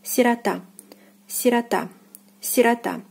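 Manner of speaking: "сирота" said si-ra-TA, end stressed; "сирота" with stress on the last syllable; unstressed O as a. Shown as a demonstration. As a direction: repeat "сирота" apart, ah in place of O. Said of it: "is pronounced as a".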